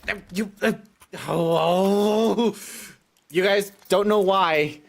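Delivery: high, loud, rabid cartoon yelling; rabid cartoon character. Real, not acted. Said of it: "I just sound like a rabid cartoon character".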